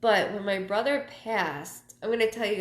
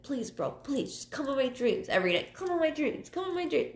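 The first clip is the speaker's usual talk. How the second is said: begging, rising higher with each repeat; pleading; 7 semitones higher, faster than usual. Retold or imitated. Begging her late brother to visit her dreams, pleading. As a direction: plead more and more desperately. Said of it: "I'll be like".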